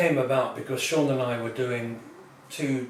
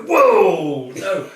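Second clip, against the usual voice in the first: loud, higher startled recoil; startled whoa; 6 semitones higher, 12 dB louder than usual. Retold or imitated. Startled diner recoiling from the robots, loud. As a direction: jump back in alarm.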